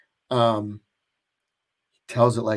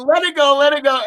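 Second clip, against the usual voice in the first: mock dad; 12 semitones higher, 5 dB louder, faster than usual.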